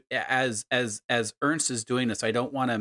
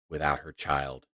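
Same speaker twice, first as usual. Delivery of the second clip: low, hushed storyteller voice; story narration; 4 semitones lower than usual. A narrator's reading. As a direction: draw them into the eerie vision. GM narrates vision.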